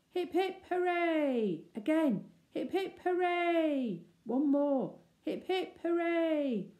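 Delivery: high, loud hip hip hooray; children cheering at the enemy. Acted. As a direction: cheer to show no fear.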